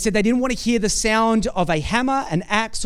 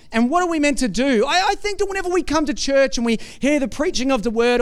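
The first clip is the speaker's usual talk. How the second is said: usual speaking voice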